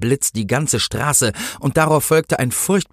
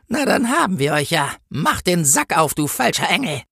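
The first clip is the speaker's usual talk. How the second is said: higher-pitched, menacing order; threatening policewoman; 8 semitones higher than usual. A narrator's reading. Policewoman closes in on the false angel, high and threatening. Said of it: "Drohend trat sie auf den Engel zu".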